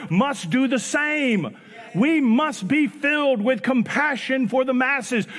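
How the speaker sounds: fervent sermon climax; preaching build. Acted. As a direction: drive it home from the pulpit. Preaching at full force.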